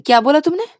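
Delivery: sharp, high, loud demand; challenging. Acted. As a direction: dare him to repeat it. Rani returns, challenging. (गुस्से में चुनौती)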